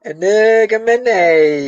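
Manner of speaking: very slow, drawn-out "Nargamin"; "Nargamin" said again, long and deliberate; exaggerated slow repeat. Shown as a demonstration. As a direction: stretch out every syllable of "Nargamin" on request. Repeats the name slowly on request.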